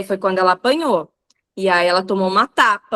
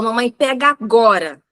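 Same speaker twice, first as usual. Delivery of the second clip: louder, insistent little-kid order, imitated; demanding child; 4 dB louder than usual. Mom quoting her daughter demanding the diaper, bossy child. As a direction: lightly mimic a bossy small child. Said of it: "e falou".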